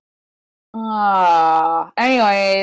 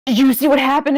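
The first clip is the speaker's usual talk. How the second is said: higher, louder panicked ask; mock frantic question; 6 semitones higher, 6 dB louder than usual. Wrestlers asking injured men, mock panic, higher. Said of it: "They're like".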